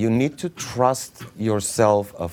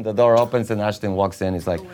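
ordinary talk, nothing marked

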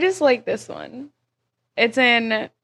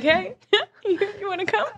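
imitating co-host; 10 semitones higher, faster than usual. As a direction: tease with a high, small pleading voice. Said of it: "that's you every night when you hear something".

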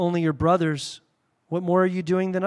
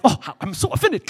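mock offended; 3 semitones higher, 5 dB louder than usual. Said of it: "you're like".

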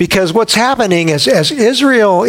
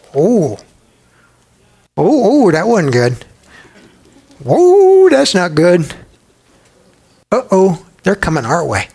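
mock alarm. King Balak watching Israel approach, hammed-up alarm. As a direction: play a king getting nervous.

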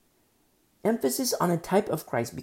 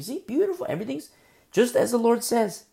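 beautiful sung out much higher; high, louder joyful exclaim; delighted; 8 semitones higher, 4 dB louder than usual. Real, not acted. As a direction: exclaim with joy. Preacher delighted by obedience.

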